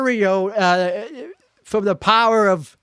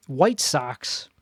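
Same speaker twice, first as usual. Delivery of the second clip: ordinary delivery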